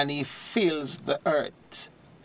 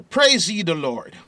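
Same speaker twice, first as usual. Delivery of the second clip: preaching at full force; 2 semitones higher, 7 dB louder than usual. Real, not acted. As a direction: proclaim it like the pulpit shakes. Preacher reading Psalm to listeners.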